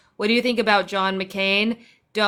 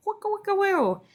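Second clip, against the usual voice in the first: high, playful record-scratch imitation; DJ scratch noise; 12 semitones higher than usual. Streamer plays DJ for viewers, high scratch sounds. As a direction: squeak it out like a DJ scratching. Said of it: "Look, I'm a D J".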